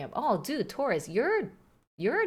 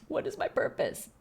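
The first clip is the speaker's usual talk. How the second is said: whispered robot; 5 semitones higher than usual. Retold or imitated. Quoting the butter robot.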